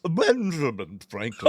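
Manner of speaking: lightly put-on Franklin voice; hammed-up name announcement; mock Franklin voice. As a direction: another guess at how Franklin sounded. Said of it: "how would he sound".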